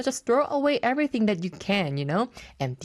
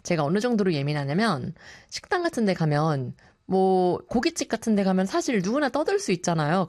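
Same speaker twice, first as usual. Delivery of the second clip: ordinary delivery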